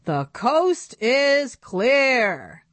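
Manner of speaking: uncle calling the dolls out at night; called out. Acted. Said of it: "Uncle Dol who called".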